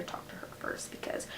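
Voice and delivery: hushed confidential whisper; whispered aside. Real, not acted. Teen vlogger confiding that she needs her mom's permission, whispering. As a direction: lean in and whisper it.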